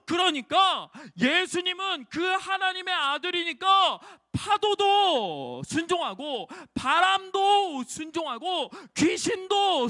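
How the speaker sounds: put-on slow preaching cry to the children; preaching climax. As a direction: proclaim each thing that obeys, slow and ringing.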